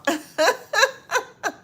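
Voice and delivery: shrieking laugh, very high and loud. Real, not acted. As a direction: burst out in a squealing laugh. Laughing at a viewer comment.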